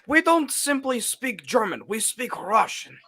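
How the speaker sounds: high-pitched hammed-up Russian accent. Mocking a friend's audition for a German role, in a high Russian accent. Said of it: "he had a Russian accent".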